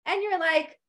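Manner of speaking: ordinary talk, nothing marked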